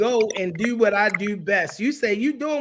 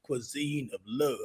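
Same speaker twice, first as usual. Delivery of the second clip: much lower, softer and slow, announcer-deep; 7 semitones lower, 9 dB quieter, slower than usual. Shown as a demonstration. Host announces the chef's business name, in a deep voice. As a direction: boom the name out like a movie trailer. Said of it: "I gotta put my deep voice on when I say it".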